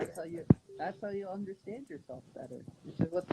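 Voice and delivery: muffled, distant phone audio; underwater-sounding bad connection. Real, not acted. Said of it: "It sounds like you're underwater".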